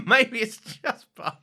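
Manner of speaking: high, loud, slow laughing echo; laughing repeat. Retold or imitated. Co-host repeating the joke, laughing high and loud. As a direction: echo the line while laughing.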